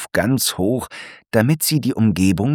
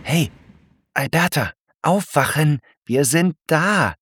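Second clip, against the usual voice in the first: lightly raised friendly zebra voice, calling her awake; Franz waking Alberta; 8 semitones higher than usual. Acted. Franz wakes his snail friend Alberta, a lightly raised friendly voice. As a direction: gently rouse a sleeping friend.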